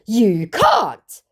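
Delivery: high, loud mock protest; hammed-up objection; indignant scammer. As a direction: exclaim it as an affronted scammer. Voicing the scammer's message.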